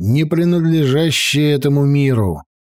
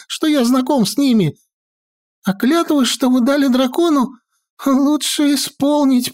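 much higher, anxious warning wizard; high fussy wizard voice; 10 semitones higher than usual. Acted. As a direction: warn them nervously, rattling on. Maestro warns of dragons.

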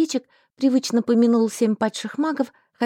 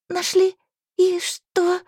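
choked surprise; 8 semitones higher, much slower than usual. Acted. Heroine shocked, voice breaking.

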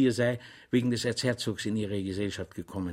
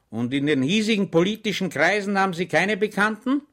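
much higher, louder probing; suspicious interrogator; 8 semitones higher, 5 dB louder than usual. A narrator's reading. Official questioning Schweig, much higher and louder. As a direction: fish for a confession.

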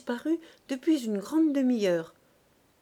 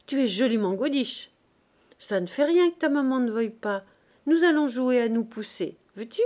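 put-on loud, higher, bold boyish teasing; cheeky street boy; 3 semitones higher, 6 dB louder than usual. A narrator's reading. Urchin coaxing a little girl to play, cheeky. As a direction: egg her on, brash and loud. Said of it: "très dégourdi et très entreprenant".

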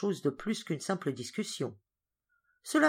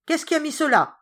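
high, loud furious cry; angry outcry; 11 semitones higher, 9 dB louder than usual. Acted. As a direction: shout it out in anger. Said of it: "s'écria-t-il avec colère".

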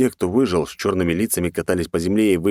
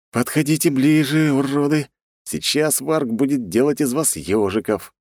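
higher-pitched taunt; battle-ready challenge, pitched up; relishing the fight; 8 semitones higher than usual. Acted. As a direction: goad the enemy eagerly. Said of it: "предвкушая битву".

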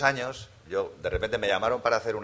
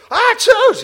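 un estornudo fuerte y exagerado, muy agudo; estornudo imitado; 14 dB louder than usual. Retold or imitated. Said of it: "Y estornudaba". (estornudo ruidoso de un actor resfriado)

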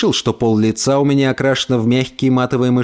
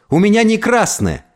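high-pitched, upset protest; tearful angry cry; 8 semitones higher than usual. A narrator's reading. Boy insists to his mother it isn't red, tearful angry cry. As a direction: insist hotly, near tears. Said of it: "задыхаясь от гнева и слёз".

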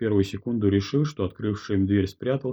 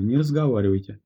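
ordinary delivery

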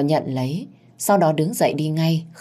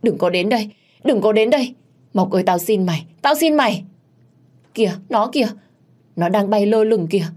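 terrified screaming; 6 semitones higher than usual. A narrator's reading. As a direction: scream it as if seeing a ghost. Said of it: "ôm đầu và la hét". (la hét hoảng loạn)

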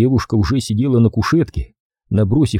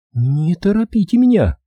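frowning; 9 semitones higher than usual. A narrator's reading. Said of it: "нахмурилась она".

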